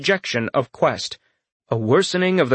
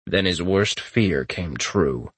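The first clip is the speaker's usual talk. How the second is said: ordinary delivery, nothing marked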